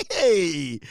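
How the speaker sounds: usual speaking voice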